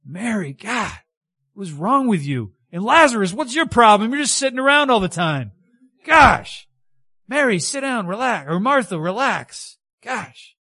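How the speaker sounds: high, loud, fuming rant; steaming Judas. Retold or imitated. Judas fuming at Mary, Lazarus and Martha, high and loud. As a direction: let the anger boil over at everyone. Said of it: "oh, steaming".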